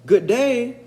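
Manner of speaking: high, loud bright hello; cheery greeting. Acted. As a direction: call out a cheerful good day. Finn greets leprechaun.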